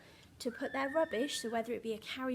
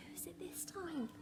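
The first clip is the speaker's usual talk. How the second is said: gasp, then a hushed question; breathless whisper of suspense; 6 semitones higher, 9 dB quieter than usual. Real, not acted.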